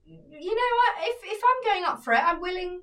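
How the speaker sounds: high, bold pep talk to yourself; confident self-talk. Retold or imitated. Telling a friend to back herself: high, confident self-talk. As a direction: psych yourself up, chin up and high.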